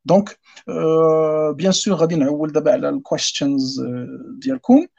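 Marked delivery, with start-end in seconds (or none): none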